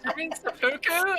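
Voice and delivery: incredulous. Real, not acted. Mocking the Sudoku joke.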